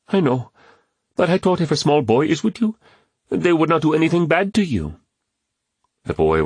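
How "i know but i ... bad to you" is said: put-on higher, pleading young boy; boy's voice. A narrator's reading. Kwame apologising to James, pleading boy's voice. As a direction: plead like a small earnest boy.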